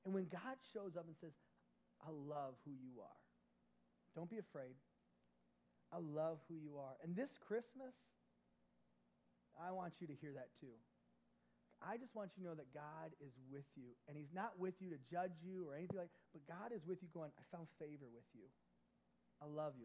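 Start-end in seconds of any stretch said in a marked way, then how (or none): 2.1-7.0 gentle voice of God. Acted. God's loving words to Mary. 17.4-19.8 soft loving God voice. Acted. God's tender words to the listener.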